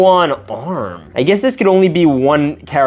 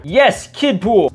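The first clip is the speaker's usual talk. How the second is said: usual speaking voice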